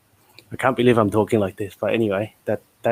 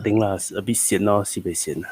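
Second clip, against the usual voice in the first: casual Singlish with lah and lor; easygoing Singapore street English; 3 semitones lower, 6 dB quieter than usual. Acted. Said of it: "I'm gonna try and speak some Singlish".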